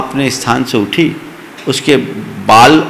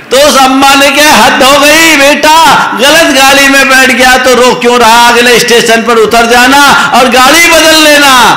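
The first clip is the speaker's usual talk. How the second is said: put-on exasperated old woman; 12 semitones higher, 5 dB louder than usual.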